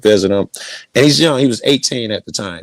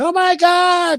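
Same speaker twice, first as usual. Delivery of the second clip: much higher gasped exclamation; an astonished mom seeing her son awake; shocked mother; slower than usual. Retold or imitated. Mother finding her son woken from the coma, astonished and much higher. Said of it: "his mom come in".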